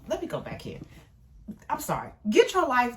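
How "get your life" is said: loud high sassy call. Real, not acted. To viewers, loud sassy cheer. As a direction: throw it out with attitude.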